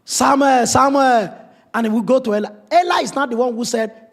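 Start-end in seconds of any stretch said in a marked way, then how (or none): 0.0-1.4 loud preaching, pitch pushed high; rising to a fiery sermon peak; full-force preaching. Acted.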